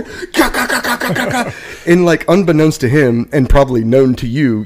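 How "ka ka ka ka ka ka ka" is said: high rapid gun noises; imitated gunfire. Mimicking his buddy shooting, vocal gunfire. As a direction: rattle off mock rifle fire with the voice. Imitates rifle fire in the story.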